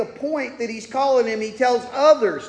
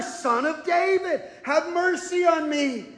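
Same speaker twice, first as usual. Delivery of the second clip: beggar's cry; 4 semitones higher, 5 dB quieter than usual. Retold or imitated. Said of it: "But he cried out all the more".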